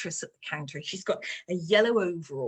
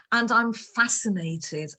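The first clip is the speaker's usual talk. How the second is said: usual speaking voice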